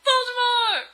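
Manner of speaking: high, loud melodramatic cry; hammed-up shriek; dramatic reveal shout. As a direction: shout the villain's name in mock horror. Dramatic reveal in fiction reading.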